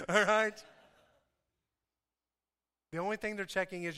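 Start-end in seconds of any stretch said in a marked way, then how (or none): 0.0-0.6 laughing. Real, not acted. Laughing after blowing a line.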